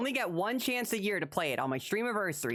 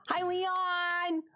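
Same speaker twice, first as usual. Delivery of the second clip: cutesy high greeting; 13 semitones higher than usual. Acted.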